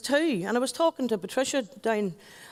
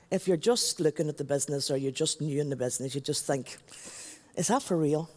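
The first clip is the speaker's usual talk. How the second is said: much lower, softer confession; confiding hush; 8 semitones lower, 6 dB quieter than usual. Real, not acted. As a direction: draw them in quietly. Confiding doubt to audience.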